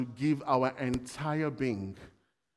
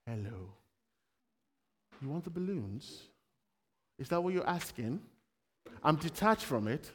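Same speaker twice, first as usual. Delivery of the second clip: quiet aside; 4 semitones lower, 6 dB quieter than usual. Real, not acted. Preacher quietly talking to a child about balloons. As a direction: drop the voice for a side chat.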